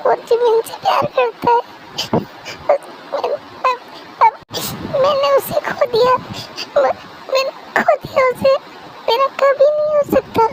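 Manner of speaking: heartbroken, halting and high-pitched; crying. Acted. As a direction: sob the words out in broken pieces. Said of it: "कब से रो रही हो?".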